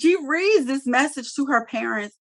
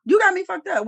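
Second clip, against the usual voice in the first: indignant rant; 8 semitones higher than usual. Pretend. Ranting for Ben.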